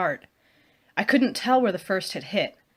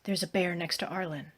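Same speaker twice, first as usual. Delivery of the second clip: hushed, softer urgent warning; softly; 6 dB quieter than usual. Acted. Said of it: "I said softly".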